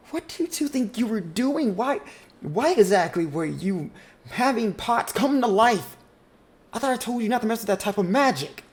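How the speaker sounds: high, loud scolding; scolding older sister. Acted. Older sister scolds her siblings over the pot. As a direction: tear into her siblings for the magic.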